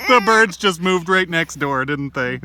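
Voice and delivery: laughing through the words. Acted. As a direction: chuckle as you talk. Friend laughing.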